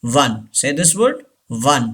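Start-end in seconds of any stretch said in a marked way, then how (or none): 0.0-0.4 WON as WUN; like "one". Shown as a demonstration. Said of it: "like O N E". 1.5-1.9 WON as WUN; like "one". Shown as a demonstration. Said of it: "like O N E".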